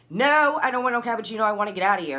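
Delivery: loud, high burst, then easing down; acting out a fed-up refusal; indignant refusal. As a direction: snap back a heated no. Acted angry refusal of offer.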